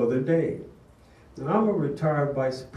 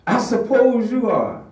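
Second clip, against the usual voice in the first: high, loud accusing jab; scornful yell; 9 semitones higher, 9 dB louder than usual. Retold or imitated. Furious mother blames the vice principal, yelling. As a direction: spit it at him, full volume. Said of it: "She was still screaming at the top of her voice".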